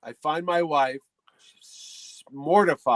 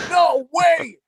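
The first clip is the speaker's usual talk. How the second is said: high, loud cry of alarm; mock alarmed shout; 11 semitones higher, 10 dB louder than usual. Harrison Ford sees the backwards camera, mock alarmed shout. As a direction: yell it as if to stop a disaster.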